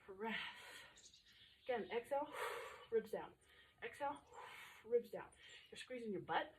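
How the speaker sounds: ordinary delivery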